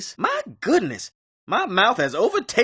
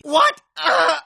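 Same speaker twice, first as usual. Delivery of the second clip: panicked cry; 6 semitones higher, slower than usual. Acted. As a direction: shout for the doctor in panic.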